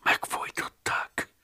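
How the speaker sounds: slow, breathless frightened whisper. A narrator's reading. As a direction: let the word slip out in fright. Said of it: "lelte riadtan Umberto". (riadt, lassú suttogás)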